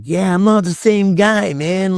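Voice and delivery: high strained protest; retold desperate plea. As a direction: imitate begging the cop, pitch up. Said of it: "I'm like".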